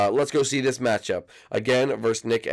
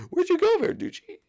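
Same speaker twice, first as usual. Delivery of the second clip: mock wail; 3 dB louder than usual. Mock wailing at Verducci leaving.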